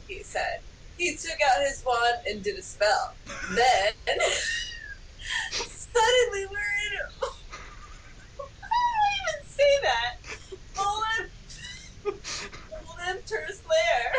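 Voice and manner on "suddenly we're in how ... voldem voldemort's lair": squeaking higher through giggles; laughing. Real, not acted. Reader loses it over the silly text, giggling. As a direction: fight back laughter as you read.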